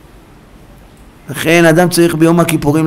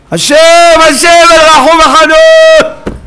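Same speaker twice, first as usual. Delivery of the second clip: high, slow full-voiced plea; crying out in prayer; 13 semitones higher, 5 dB louder, slower than usual. Shown as a demonstration. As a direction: stretch the divine name in a shout. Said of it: "צועק". (זעקת תחינה רמה)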